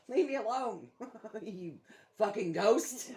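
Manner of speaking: mock-angry laughing at the pestering ghost; laughing shoo-off. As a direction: laugh while telling a ghost off.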